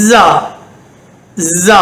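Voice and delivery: ஜா as English 'zaa'; ஜா begun with a long z; buzzing Z sound. Shown as a demonstration. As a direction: buzz a z through the teeth on ஜா. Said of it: "இது இசட்டுடைய சத்தம்".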